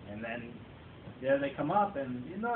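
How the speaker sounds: normal talking voice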